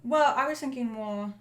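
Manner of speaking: ordinary delivery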